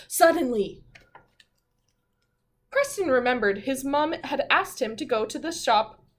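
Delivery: storybook narration, building to suddenly; story reading. A narrator's reading. Teacher reading the class a story, with a suspenseful pause. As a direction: read it like a picture book for kids.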